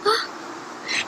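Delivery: sharp gasp. Acted. Bear realizes he missed fun.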